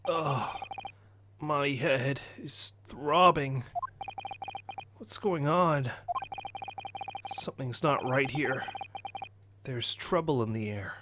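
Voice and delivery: quiet, dazed waking voice; groggy hero coming round. Acted. Game hero waking with a throbbing head, groggy and quiet. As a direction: murmur it through a throbbing head.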